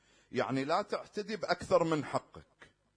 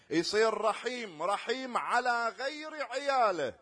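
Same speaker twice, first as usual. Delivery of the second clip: preaching; 7 semitones higher, 6 dB louder than usual. Acted. Preacher urging the congregation to mercy, raised and emphatic. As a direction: drive the point home to the congregation.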